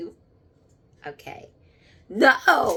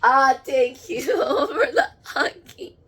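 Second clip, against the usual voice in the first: slow, louder, laughing and crying; tearful laughing thanks; 4 semitones higher, 5 dB louder, slower than usual. Real, not acted. Medium thanking the spirits for hugs, laughing through tears.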